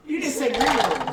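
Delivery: high, loud gotcha; loud accusing. Real, not acted. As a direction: call them out loudly. Players tease GM together.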